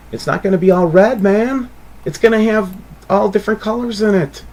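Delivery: real frustration boiling over as you explain; frustrated. Real, not acted. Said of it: "I don't know why I'm getting frustrated".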